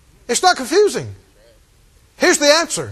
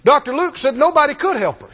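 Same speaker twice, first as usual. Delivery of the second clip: normal talking voice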